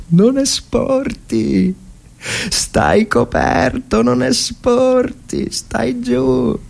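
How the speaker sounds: high, slow, tense warning to a comrade; anxious soldier pleading to stay covered; urgent warning. Acted. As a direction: hold him down with your voice, stretched and high. Soldier warned to keep down.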